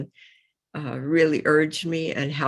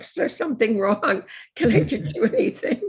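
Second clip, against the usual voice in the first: higher, concerned waitress voice; waitress asking; 7 semitones higher than usual. Retold or imitated. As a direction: voice the worried waitress, pitched up. Waitress voiced in retold story.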